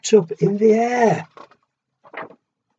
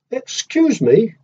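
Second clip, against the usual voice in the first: polite cricket; 7 semitones higher than usual. Acted. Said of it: "coughed a cricket".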